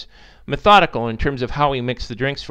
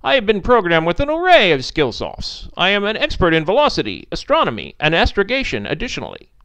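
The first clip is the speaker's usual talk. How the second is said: loud, higher, precise robotic recital; stilted artificial-intelligence voice, raised and emphatic; formal former-AI missile; 6 semitones higher, 4 dB louder than usual. Acted. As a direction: reel off specifications like an eager machine. Missile lists its programmed skills.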